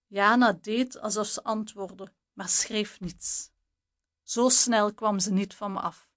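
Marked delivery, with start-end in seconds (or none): none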